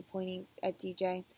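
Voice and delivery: usual speaking voice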